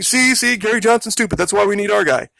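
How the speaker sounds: high, sneering mock voice of smug pundits; sing-song jeer at a rival candidate; mocking smug quote. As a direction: parrot them in a high taunting voice.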